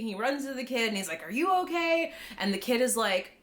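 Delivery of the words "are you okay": high-pitched mock worried question; imitated panic. Gunso runs to the kid, imitated panic. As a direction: ask it in pretend panic, pitched up. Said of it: "He's, like, totally panicking".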